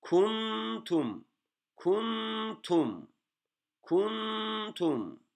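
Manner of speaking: "Kuntum" pitched high and sustained like chanting; high recitation. Shown as a demonstration. Teacher chanting the word high for students to copy. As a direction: lift "Kuntum" high and hold it. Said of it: "diye okuyoruz".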